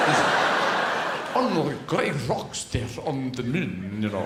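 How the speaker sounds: put-on slow, shaky-quality borrowed Russian accent. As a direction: do a dubious, drawn-out Russian accent. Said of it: "I've borrowed this accent from a shop".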